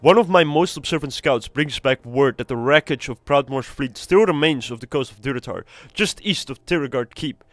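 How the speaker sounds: reading the orc commander's quest text aloud. Acted. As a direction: lend the quest giver some weight.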